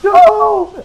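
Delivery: high, loud drawn-out cry; anguished scream. Retold or imitated. Recalling an actor pouring his heart out, anguished scream. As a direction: imitate a dramatic anime scream, high and loud. Imitates an anguished anime scene.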